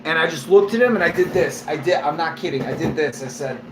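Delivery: ordinary talk, nothing marked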